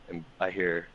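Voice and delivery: ordinary delivery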